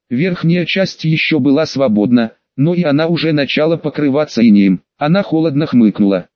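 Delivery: ordinary delivery, nothing marked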